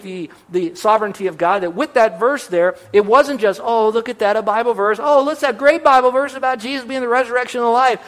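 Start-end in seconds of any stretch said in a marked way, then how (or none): 3.6-8.0 breezy, high, light dismissive tone; mock offhand admiration. Pastor mocking a casual glance at the verse, breezy. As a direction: toss it off like a tourist glancing at a plaque.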